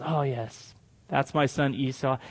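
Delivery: low, soft, lightly voiced old father; a hint of a dying patriarch; satisfied old father. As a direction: drop low and quiet, as if reassured. Blind old Isaac is satisfied.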